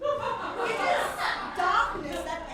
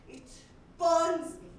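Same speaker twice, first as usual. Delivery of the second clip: loud, higher, building outburst; frenzied intensity; 3 semitones higher, 9 dB louder than usual. Acted. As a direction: build to a loud, tormented climax. Monologue climax of inner torment.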